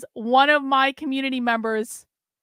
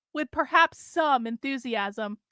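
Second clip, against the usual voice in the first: usual speaking voice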